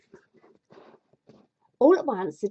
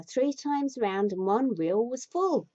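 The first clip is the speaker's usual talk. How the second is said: ordinary talk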